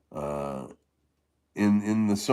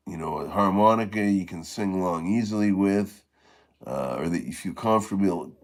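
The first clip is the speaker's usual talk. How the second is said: usual speaking voice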